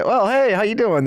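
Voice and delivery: high, breezy hey-how-you-doing; hammed-up politician's canned hello; mock hollow greeting. DeSantis greeting, mocked.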